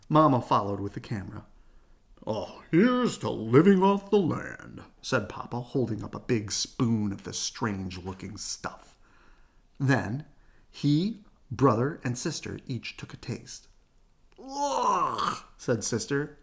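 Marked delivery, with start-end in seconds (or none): 2.2-4.8 proud Papa Bear. Acted. 14.4-15.4 disgusted ugh. Acted. Sister disgusted by taste.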